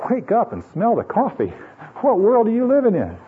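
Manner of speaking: high, incredulous rebuke; mock scolding. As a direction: scold them playfully, pitch leaping up. Mock scolding of naive listeners.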